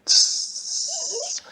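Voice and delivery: a high hissing sizzle of meat; sizzle sound. Acted. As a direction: make the grill sizzle. Patties hit the grill.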